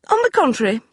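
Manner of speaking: icy well-bred woman; cool crisp lady. Acted. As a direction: brook no argument. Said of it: "she announced in a cool, crisp voice".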